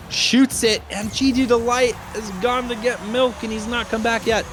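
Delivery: high-pitched, excited play-by-play; excited goal call. Real, not acted. Caster calling a goal on an absent defender, excited. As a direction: ride the goal up high and keep it there.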